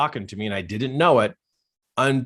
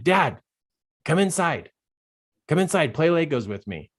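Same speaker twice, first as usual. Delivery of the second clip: higher, lightly voiced child calling Dad; little boy; 6 semitones higher than usual. Little boy invites his dad inside, pleading. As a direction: imitate a small son asking to play. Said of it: "he's looking up at me saying".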